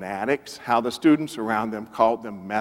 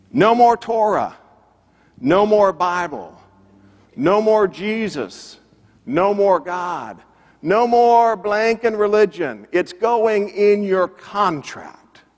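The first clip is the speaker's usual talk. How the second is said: imitating a boss's yell, high-pitched, phrase by phrase; retold yelling; 7 semitones higher, slower than usual. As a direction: hammer out each demand like an angry record executive. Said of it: "he's yelling at [name]".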